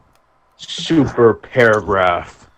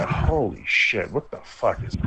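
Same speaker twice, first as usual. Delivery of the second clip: shocked whisper; 9 dB quieter than usual. Retold or imitated. Shocked aside at club.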